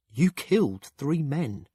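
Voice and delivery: hesitant build into a slow accusation; accusing. Acted. Acted confrontation, halting accusation.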